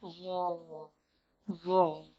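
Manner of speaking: ZO held long and low with a heavy, rounded vowel; emphatic heavy. Shown as a demonstration. Teacher shows it "like this", heavy sound. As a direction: say a deep, heavy "Zo". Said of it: "should be very emphasis and heavy".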